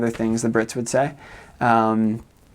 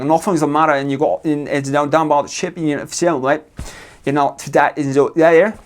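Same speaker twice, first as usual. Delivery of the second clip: higher, louder mock Liverpool accent; Scouse accent; 4 semitones higher, 4 dB louder than usual. American traveler mimicking people from Liverpool, mock Scouse accent. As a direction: imitate a barely intelligible Scouse speaker. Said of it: "from Liverpool, like, a Scots".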